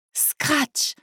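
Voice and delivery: high storytelling lightning crack; lightning sound effect. A narrator's reading. Storyteller as lightning lights the sky, high imitated crack.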